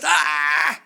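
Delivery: sudden loud pained cry from the devil; loud startled yelp; Satan's recoiling cry. Retold or imitated. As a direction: shriek like scripture burns. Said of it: "He goes".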